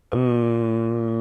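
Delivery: long steady hum through the nose; humming. Shown as a demonstration. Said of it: "we're humming".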